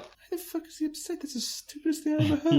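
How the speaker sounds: high, hushed squeak of mock disbelief; mock incredulous parent. Imagined parent hearing why child is upset, mock incredulous. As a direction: play a baffled parent in a tiny high voice.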